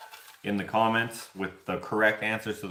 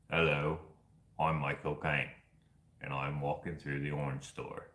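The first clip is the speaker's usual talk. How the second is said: low, hushed British actor impression; Michael Caine; 6 semitones lower, 8 dB quieter than usual. Joke commentary over footage in the store, low Michael Caine impression. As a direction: narrate softly in a put-on English actor's voice. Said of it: "put commentary over the video".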